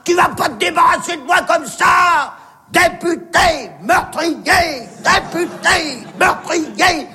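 high, chanted protest yell; hammed-up outraged bawling; hollering. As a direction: holler accusations from the top of a crane. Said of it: "J'ai commencé à gueuler".

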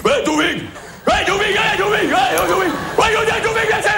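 high mock call across the room; hammed-up high-pitched hollering of Douvig; imitating Alain calling. Mimicking Alain yelling for Toufik to come. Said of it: "Il fait comme ça".

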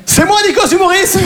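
high, laughing shout; laughing outburst. Retold or imitated. Comedian telling the absurd punchline, laughing shout. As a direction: blurt it out cracking up.

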